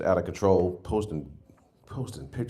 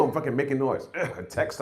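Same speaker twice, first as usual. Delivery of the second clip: ordinary delivery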